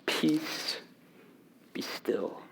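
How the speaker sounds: soft, breathless whisper; whispered command. A narrator's reading. Jesus calming the sea, whispered. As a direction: hush the sea gently. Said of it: "And he said to the sea".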